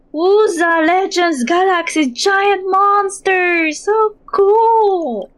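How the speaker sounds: high, loud, slow amazed cry; a kid bursting with wonder; excited. Acted. Child thrilled by the story.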